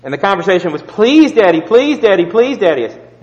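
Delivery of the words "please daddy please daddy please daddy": pleading, rising please after please; begging child. Retold or imitated. Son begging Daddy at the table, pleading. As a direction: beg like a small boy wanting his toy back.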